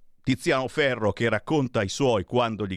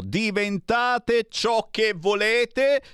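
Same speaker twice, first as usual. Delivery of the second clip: high, slow, drawn-out parroting; sarcastic high repetition; mock echo; 11 semitones higher, much slower than usual. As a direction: stretch the slogan out in a teasing singsong. Echoes Ferro's line mockingly.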